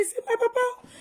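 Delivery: sudden high cry of grief; high wail. Retold or imitated. Daughter crying out over her dead father, high wail. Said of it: "I say".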